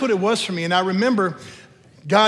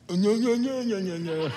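mouth full; 4 semitones higher than usual. Retold or imitated. Answering with mouth full at dentist.

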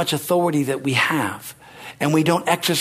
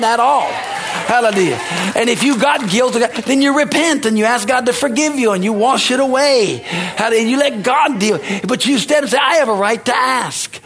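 higher-pitched, driving sermon delivery; full-force preaching; 6 semitones higher than usual. Real, not acted. Preacher telling the congregation they have authority, impassioned preaching.

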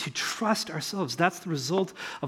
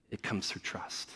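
nearly whispered, much softer; hushed; 9 semitones lower, 12 dB quieter than usual. Real, not acted. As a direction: lean in and breathe the point. Preacher drops to hushed key point.

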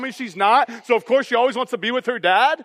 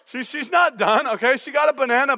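ordinary talk, nothing marked

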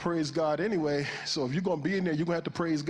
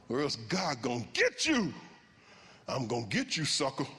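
lightly menacing mock warning; mock threat; 3 semitones lower than usual. Shown as a demonstration. Preacher parodying his old reading of scripture, mock threatening. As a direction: taunt them as if judgment is coming. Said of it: "that's how I always read that".

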